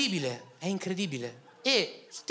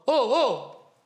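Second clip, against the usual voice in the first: excited fans; 6 semitones higher, 4 dB louder than usual. Retold or imitated. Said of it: "mi fanno".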